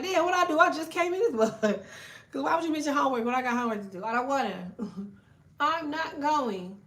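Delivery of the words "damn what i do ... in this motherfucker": higher, louder put-upon protest; mock offended demon. Demon protesting it just arrived, higher and louder. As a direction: play the demon acting wronged. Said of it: "They're like".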